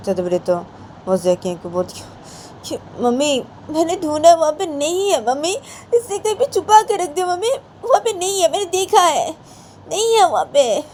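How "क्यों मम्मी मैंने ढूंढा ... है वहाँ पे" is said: high, louder whining voice of a mother; whiny complaint. Acted. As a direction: complain shrilly to mother-in-law about the child. Daughter complains to grandmother.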